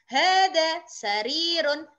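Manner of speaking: hadza maktabun, each vowel drawn out; slow vowelled reading. Shown as a demonstration. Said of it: "sambil diharokati". (hadza maktabun dibaca pelan dengan harakat)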